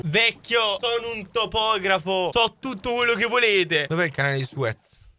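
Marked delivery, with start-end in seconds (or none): none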